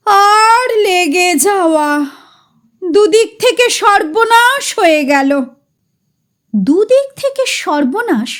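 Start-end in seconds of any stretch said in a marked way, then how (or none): 0.1-5.3 slow, high, ruined lament; voice of utter loss. Acted. Chatujje-da bemoaning the forgotten oil, forlorn lament. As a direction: drag it out like a man who has lost everything. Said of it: "সর্বহারা গলায় বলে ওঠে".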